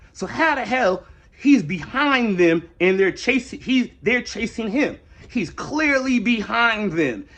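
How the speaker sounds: high, slow, exasperated disbelief; indignant, drawn-out challenge; incredulous. Real, not acted. Challenging a narrative he finds absurd.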